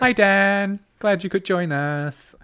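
high, slow, lilting welcome; sing-song greeting. Pretend. Sing-song greeting to new listener.

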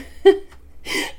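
airy unvoiced laugh escaping; breathy real laugh. Real, not acted. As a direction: crack up at the exercise. Demo makes her laugh.

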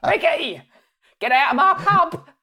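high, hammed-up Cockney yelling; mock Cockney soap shout, pitched high; EastEnders Cockney. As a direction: holler like an EastEnders Cockney landlady. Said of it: "give us an EastEnders Cockney".

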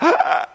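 a sudden high loud "ah" as the arm pops in; sharp cry of pain. Retold or imitated. Child yelping at the doctor when his arm pops back. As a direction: yelp as the joint snaps back. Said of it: "And I went".

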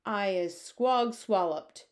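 hammed-up slow giant bellow; giant's bellow. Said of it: "bellowed the bone cruncher".

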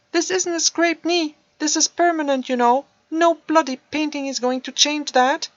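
loud, high, bitter; loud high bitter outburst; angry teen. Acted. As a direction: throw it in his face. Teen angry about his paralysis.

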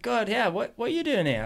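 high surprised question; surprised friend. Retold or imitated. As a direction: sound caught off guard. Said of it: "He's like".

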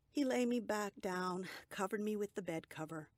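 plain reading voice

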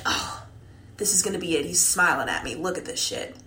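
quietly talking yourself up, as if retelling it; retold hushed self-talk. Club worker to herself on seeing a smile, hushed retold self-talk.

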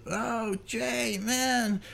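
high, drawn-out excited greeting, imitated; stretched-out, high-voiced amazement at seeing someone; effusive greeting. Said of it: "He goes".

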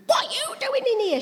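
high, shocked cry at the wardrobe; startled cry. Retold or imitated. Daughter finding mum in the wardrobe, shocked and high.

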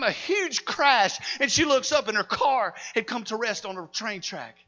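high-pitched, driving narration of an attack; dramatic sermon storytelling. Real, not acted. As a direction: build the story to its climax. Preacher tells climax at full pitch.

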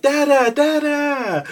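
put-on very high, slow toddler call. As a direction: call out like a small child spotting his dad. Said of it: "Nova's just like".

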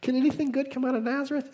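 high, quick, mocking disbelief; lighthearted sarcasm. Nathanael scoffing at Philip's news about Nazareth, lighthearted sarcasm. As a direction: scoff at the hometown with a grin. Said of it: "like, lighthearted sarcasm".

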